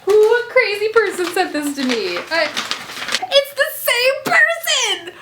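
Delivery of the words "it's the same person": high, slow, teary amazement; teary squeal. Real, not acted. Vlogger realizing the same fan sent more, teary. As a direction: break into happy tears.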